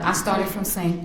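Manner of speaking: usual speaking voice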